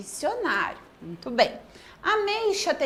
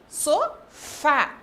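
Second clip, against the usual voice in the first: "Sofá" split as so, then a stronger fá; "Sofá" in two beats, weight on the last; stressed syllable shown. Shown as a demonstration. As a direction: separate so from fá and lean on fá. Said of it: "Sílaba forte".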